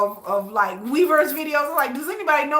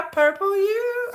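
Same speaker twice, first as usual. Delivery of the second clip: high-pitched farewell; 10 semitones higher than usual. Real, not acted. Farewell to fans.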